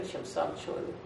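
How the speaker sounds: normal talking voice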